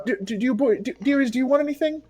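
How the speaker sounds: high, soft hostess voice; fussing Ma. Acted. Ma offering the boys food, high and soft. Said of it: "She'll go get his pipe".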